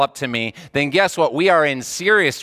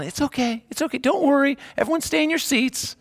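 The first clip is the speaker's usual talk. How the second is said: much higher, sing-song soothing stewardess voice; mock cheerful stewardess; 10 semitones higher than usual. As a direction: fake calm for the passengers, lightly hammed. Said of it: "keeping a nice face on".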